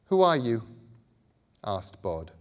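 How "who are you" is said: louder, tense question to the ghost; nervous boy. A narrator's reading. Bod confronting the indigo man, tense and wary. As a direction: brace yourself and ask. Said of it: "He squeezed Scarlet's hand as he said it".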